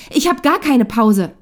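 loud, high, drawn-out wail of protest; sobbed protest. A narrator's reading. Wife cutting into her husband's account, sobbing out a protest. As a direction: burst in, aggrieved and tearful. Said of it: "schluchzt Jule".

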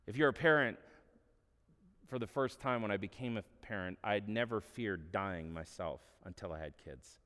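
normal talking voice

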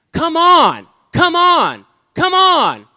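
high, loud repeated call; loud insistent call. Retold or imitated. God calling him straight to himself, loudly insisting. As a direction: keep calling him in, stronger each time. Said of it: "God's like".

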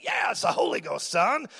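lightly mimicked revival preacher, rising on the last word; mock Holy Ghost zap, pitching up on "son"; imitated preacher. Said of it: "He'd be like".

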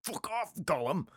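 high-pitched, put-on indignant hobbit; mock-angry retort in a high voice; Samwise Gamgee. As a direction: snap back in an exasperated high character voice. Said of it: "He's like".

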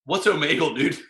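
normal talking voice

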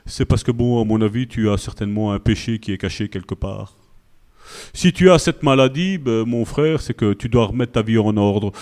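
a self-righteous brother diagnosing hidden sin; mock super-spiritual brother. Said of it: "Vous connaissez les frères super spirituels?".

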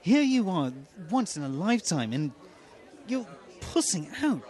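high-pitched Mythos character voice; Mythos impression. Actor dares the host's stand-in Peter Wigfield, in high Mythos voice. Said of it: "say to me in Mythos voice".

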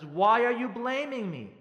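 loud, high, drawn-out accusation; defensive. Acted. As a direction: stretch it into a wounded mock protest. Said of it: "you get all defensive".

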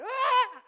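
sudden very high, very loud yelp of fright; mock scared yelp. Father realising it is all on him, mock scared yelp. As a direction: act out a jolt of panic. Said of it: "Happy, scared".

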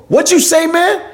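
high-pitched mock challenge; acted confrontation. Comic acting tough at a heckler for the video, high challenging voice. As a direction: play up being offended by a heckler. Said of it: "So I started acting".

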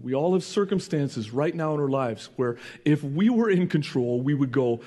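ordinary delivery

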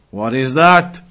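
normal talking voice

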